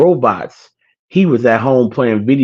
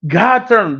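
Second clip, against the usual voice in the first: much higher, excited comic sidekick; imitating a silly movie character in awe; funny movie character; 11 semitones higher, much slower than usual. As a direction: squeal it like the goofy sidekick. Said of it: "he like a little funny character".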